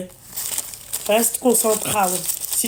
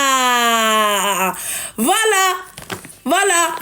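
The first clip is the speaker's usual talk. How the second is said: triumphant; much slower than usual. Real, not acted. Triumphant after the caller's answer.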